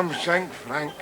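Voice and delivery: put-on quiet musing on an old nickname; musing recollection. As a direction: say it as a memory surfacing. Recalling an old schoolmate.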